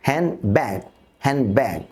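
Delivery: Handbag said as hanbag; handbag without d. Shown as a demonstration. Teacher demonstrating elision inside a word, d left out. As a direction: skip the d in the middle of Handbag. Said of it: "তাহলে আমরা টি এবং ডিকে বাদ দিয়ে দিই".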